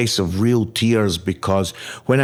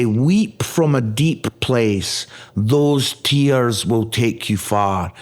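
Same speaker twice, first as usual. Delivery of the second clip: slow, weighty words, each one given space; slow solemn saying; slower than usual. Shown as a demonstration. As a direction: hand down the saying slowly and gravely. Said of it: "I just want to say".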